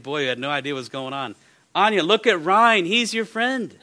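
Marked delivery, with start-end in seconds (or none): 1.8-3.8 cheerful urging. Retold or imitated. Pastor coaxing the girl, stepping up.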